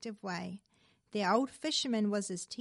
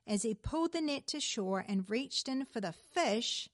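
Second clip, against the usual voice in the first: usual speaking voice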